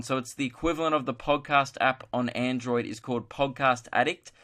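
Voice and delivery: ordinary delivery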